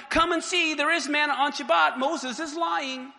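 gleefully calling the people to come look; mock calling out.

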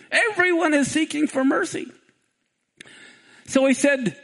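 usual speaking voice

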